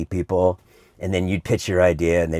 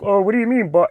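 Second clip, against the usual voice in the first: imitating Homer; 11 semitones higher, 3 dB louder, faster than usual. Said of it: "He's like".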